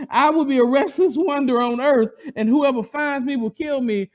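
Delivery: loud, high mock complaint; whining Cain. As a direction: play up his self-pity. Cain voiced fearfully.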